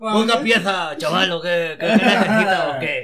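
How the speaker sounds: loud, laughing hawker calls; singsong market price calls. Retold or imitated. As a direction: hawk phone parts to passersby. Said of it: "Meave market stall sellers". (vendedor de plaza gritando entre risas)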